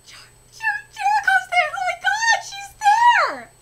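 high, loud, crying shriek of delight; fangirl shriek. Real, not acted. Fan shrieking as her favourite appears, excited.

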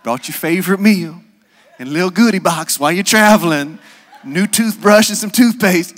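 mock doting mama; doting mother. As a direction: fuss over him proudly.

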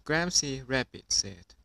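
ordinary delivery, nothing marked

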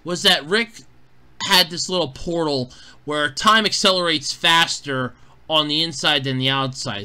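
usual speaking voice

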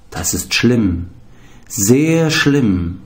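ordinary delivery

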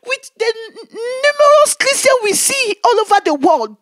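high, crying lament; weeping cry over the world's churches; tearful lament. Retold or imitated. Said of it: "I said".

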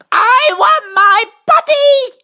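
put-on loud, high, drawn-out child's demand; shrieked toddler demand; princess crying out. A narrator's reading. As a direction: yell it slowly like a desperate little princess. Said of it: "she cried".

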